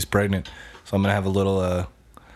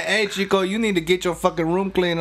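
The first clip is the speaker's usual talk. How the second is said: put-on higher, hammy Mexican mom accent; 7 semitones higher than usual. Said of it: "my impression of a Mexican joke".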